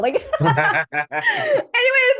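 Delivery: high, slow laughing through the word; real laughter breaking in. Real, not acted. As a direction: let the laugh take over.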